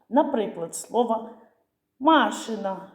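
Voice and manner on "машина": «машина» stressed on the first а, MA-shyna; stress on first syllable. Shown as a demonstration. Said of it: "помилитись наголосом". (наголос на перше «а» в слові «машина»)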